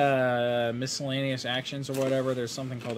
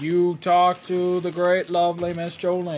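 put-on Southern accent. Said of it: "[name]'s got this a little better".

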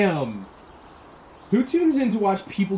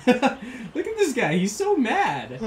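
laughing; 7 semitones higher, slower than usual. Real, not acted. Laughing at angry game character.

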